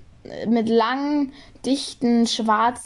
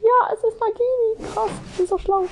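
higher, delighted exclaiming; excited realisation; 9 semitones higher than usual. Real, not acted. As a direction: exclaim it thrilled.

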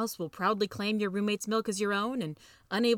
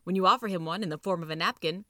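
usual speaking voice

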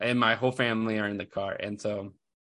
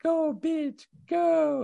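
put-on much higher little-kid voice; imitating his little son; much slower than usual. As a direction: squeak it out like a small boy. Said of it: "This kid just goes".

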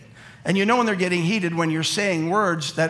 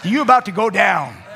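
louder laughing threat; playful loud warning; 5 dB louder than usual. Real, not acted. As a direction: warn the guys with a grin in the voice.

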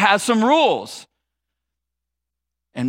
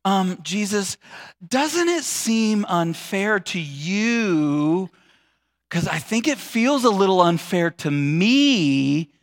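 slow, drawn-out, carefully restrained complaint; holding back; much slower than usual. Acted. Martha carefully complaining to Jesus, holding back. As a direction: stretch the words out, keeping annoyance politely in check. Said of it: "she's trying to hold back".

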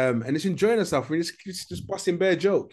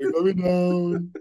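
mock announcer; 4 semitones higher, much slower than usual. Joking echo of camel ride.

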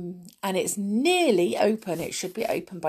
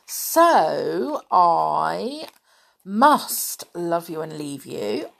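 usual speaking voice

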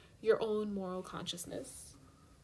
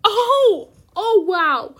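loud, high mock shock, laughing; shocked gasp; 9 semitones higher, 16 dB louder, much slower than usual. Acted shock at breakup news.

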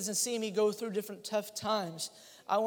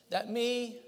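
normal talking voice

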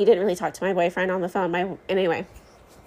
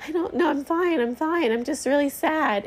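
high, teary wobble while insisting fine; retold crying; 7 semitones higher than usual. To a stranger in the hall, a teary high protest. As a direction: mimic her own tearful reply, pitched up. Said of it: "he sees me on the floor crying".

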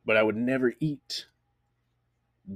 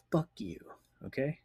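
whisper; 4 semitones higher, 6 dB quieter than usual. Real, not acted. Aside whisper at beer tasters.

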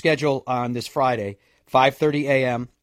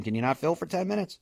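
ordinary talk

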